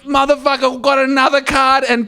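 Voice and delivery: high, laughing shout; excited punchline yell. Real, not acted. Storyteller telling co-host about a second free card, laughing yell.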